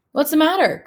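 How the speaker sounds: put-on boy anxiously asks the sad ghost. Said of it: "Cooper asks anxiously".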